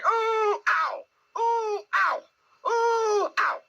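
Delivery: high alternating whoops and yelps; bounced oohs and ows. A narrator's reading. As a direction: squeal up, yelp down with each bounce. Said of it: "as he was saying".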